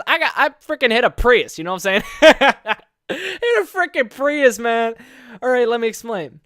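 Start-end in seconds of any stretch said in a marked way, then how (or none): 2.1-2.7 loud, high real laugh; a sudden hearty laugh; burst of laughter. Real, not acted. Laughs at hitting a Prius. 3.1-4.9 high, slow words through real laughter; laughing at his own crash. Real, not acted. Laughs at hitting a Prius.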